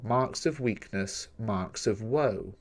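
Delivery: slow, soft, measured reading of the line; slow poem reading. Shown as a demonstration. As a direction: let each word of "Marks of weakness, marks of woe" fall slowly and quietly. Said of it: "Some lines contain seven syllables".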